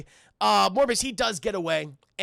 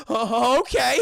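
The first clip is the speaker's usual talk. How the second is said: startled agreement; 2 semitones higher, 6 dB louder, slower than usual. Retold or imitated. Retelling Harry asked out to dinner, startled. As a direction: agree loudly, caught off guard. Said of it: "He goes".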